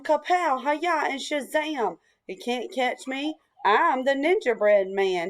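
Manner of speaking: usual speaking voice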